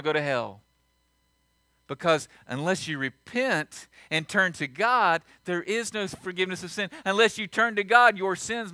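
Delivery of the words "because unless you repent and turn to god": usual speaking voice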